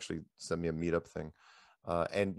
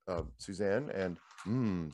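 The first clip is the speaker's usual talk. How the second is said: ordinary talk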